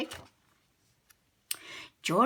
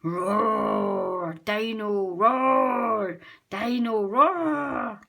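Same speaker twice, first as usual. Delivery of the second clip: loud roaring chant; booming toy dino song; toy dinosaur roaring; 7 dB louder, much slower than usual. A narrator's reading. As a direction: growl it like a noisy toy dinosaur. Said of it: "Dino Roar comes to life".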